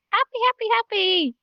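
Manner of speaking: bubbly repeated demonstration; happy. Shown as a demonstration. Teacher showing that signs need expression, happily. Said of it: "showing that with your facial expressions".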